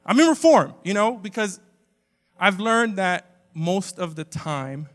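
ordinary delivery

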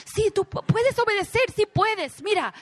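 high, bright mock pep talk; mock cheerleading. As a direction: imitate a parent boosting the child. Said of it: "es decir".

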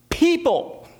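PEOPLE pitched high, then falling; emphatic contrast. Retold or imitated. Stresses the contrast with a plant.

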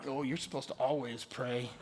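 low, soft mock piety; disapproving churchgoer. Imitating listeners judging his failed prayer, mock disapproval. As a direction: put on a pious low voice, quietly. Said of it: "Don't look at me like".

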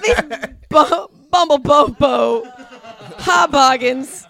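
loud, slow, laughing through the word; laughing shout. Real, not acted. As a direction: blurt it out while cracking up.

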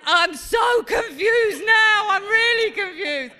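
loud, high, drawn-out wail of confusion; imitated baffled shouting. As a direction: shout it slowly as the bewildered son. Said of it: "And Harry's going".

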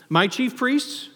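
slow, high rising question; mock disbelief. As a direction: draw it out, incredulous. Preacher voicing Jesus' rhetorical question.

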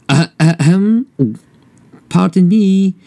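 high, hesitant tiny mouse voice; a timid mouse stammering up at a lion; nervous little mouse. Acted.

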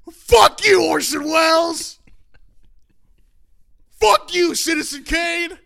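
full-volume, high reenacted yell; defiant shout. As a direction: hurl it across the classroom, stretching the ends. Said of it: "I stood up in the back of the class".

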